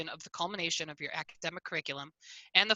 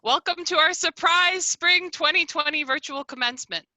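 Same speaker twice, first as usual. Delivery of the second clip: higher, lifted announcer-style welcome; ceremony welcome; 8 semitones higher, 7 dB louder than usual. Real, not acted. Host welcomes graduates to virtual commencement, upbeat ceremonial welcome. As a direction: open the ceremony with a big, raised welcome.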